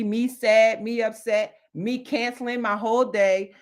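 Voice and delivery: normal talking voice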